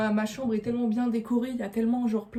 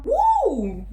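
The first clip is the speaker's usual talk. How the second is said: excited whoop; 9 semitones higher, 8 dB louder than usual. Real, not acted. Reacting to reading lines.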